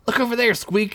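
high pointing call; excited boy. Acted. Derek spots a glint in the woods, high and eager. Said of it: "he said, pointing".

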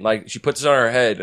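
normal talking voice

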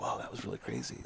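imitating someone dazed, low and soft; groggy, hushed, just coming out of trance; woozy. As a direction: let it out softly, mildly dazed. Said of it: "it's almost, like, woozy".

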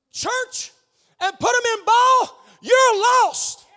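loud, high preaching cry; a preacher shouting a rebuke; shouted warning. Real, not acted. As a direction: hammer the warning home. Preacher denouncing pulling kids out.

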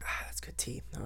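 breathy, hushed aah right after a sip; while sipping a drink. Real, not acted. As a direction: savour the tea, hushed and soft. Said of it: "I'm gonna take a sip of my tea".

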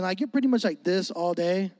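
usual speaking voice

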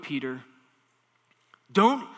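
ordinary talk

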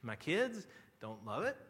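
ordinary talk, nothing marked

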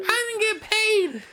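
a mock complaint in a squeaky put-on voice; whiny caller. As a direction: whine it like a customer who got no pay. Mocking complaining callers at work.

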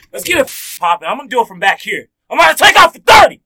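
loud, pumped-up launch into the segment; hype shout. Real, not acted. Host hyping up the crew, shouting. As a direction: yell it to kick off the show.

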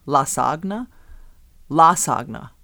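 "Lasagna" as la-SAG-na, G pronounced; hard G in lasagna. Shown as a demonstration. Co-host trying out the emailer's odd pronunciation, Lasagna with hard G. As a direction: sound out the G in Lasagna. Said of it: "pronounce the G".

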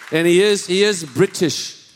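loud, high-pitched mock British accent; bad British accent. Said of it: "it's terrible".

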